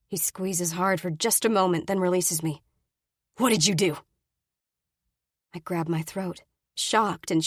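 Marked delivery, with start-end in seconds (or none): 3.4-4.0 angry demand. Acted. Brady demanding.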